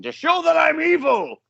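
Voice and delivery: high, slow villain shout; maniacal scream. Retold or imitated. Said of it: "that maniacal just screaming".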